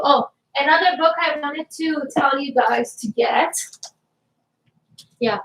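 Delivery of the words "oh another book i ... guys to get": ordinary talk, nothing marked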